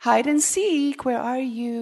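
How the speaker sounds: high, teasing hide-and-seek call; God playfully calling to hiding Adam and Eve. Pretend. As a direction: sing it out like a game with kids. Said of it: "he's playing the game".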